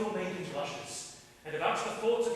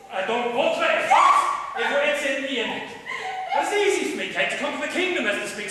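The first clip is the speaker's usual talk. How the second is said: louder, rising into a laugh; playful loud boast; 8 semitones higher, 11 dB louder than usual. Acted. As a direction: crow it out, teasing her. King boasts to French princess.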